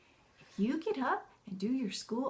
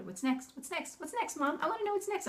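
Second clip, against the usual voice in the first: put-on high, eager pestering kid; impatient child; 8 semitones higher, 4 dB quieter than usual. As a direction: imitate a child begging to continue. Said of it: "he's climbing over my shoulder".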